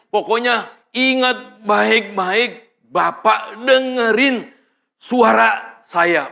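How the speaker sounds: controller's drawn-out, insistent reminder; acted insistent guidance. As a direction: stretch each word so the listener obeys. Controller drills the key instruction.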